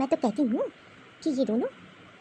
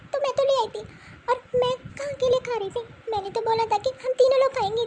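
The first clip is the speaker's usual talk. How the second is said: put-on pleading little girl; 8 semitones higher than usual. Child explains to mother, upset.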